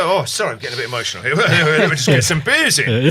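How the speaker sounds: loud, laughing, hammed-up sorry, rushed; mock embarrassed apology. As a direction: blurt the excuse out, laughing it off. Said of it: "And you say".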